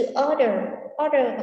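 "order" stressed on OR; strong OR, weak final syllable in "order"; stress on first syllable. Shown as a demonstration. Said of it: "mà mình sẽ nhấn vào trước nó". (nhấn âm đầu của "order")

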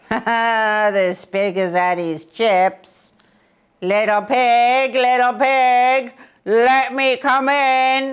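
big bad wolf, slow and loud. A narrator's reading. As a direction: lick your lips and call out slowly as the wolf. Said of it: "The wolf who saw it licked his lips and said".

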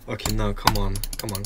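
normal talking voice

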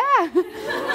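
bright high rising laugh. Real, not acted. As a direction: laugh with delighted disbelief. Laughs at anonymous money offer.

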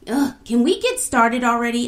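bored friend wanting the story to start; impatient. A narrator's reading. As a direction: hurry everyone along. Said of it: "asked Abinah impatiently".

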